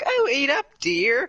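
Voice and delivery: sweet granny. Retold or imitated. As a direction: coax him like a doting grandma. Grandma voiced in story.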